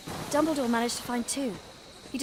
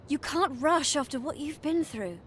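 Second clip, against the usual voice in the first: ordinary talk, nothing marked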